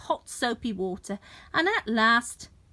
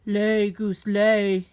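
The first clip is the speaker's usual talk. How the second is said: giant's cry; 3 semitones lower, 4 dB louder, slower than usual. A narrator's reading. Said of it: "he cried".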